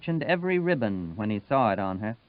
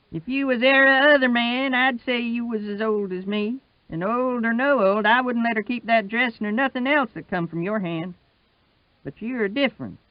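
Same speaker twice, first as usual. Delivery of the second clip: put-on higher, steady voice of old Wash; Wash's voice; 11 semitones higher, 3 dB louder than usual. Old Wash standing up to Sutphen, patient and higher. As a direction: keep it patient and steady, pitched up.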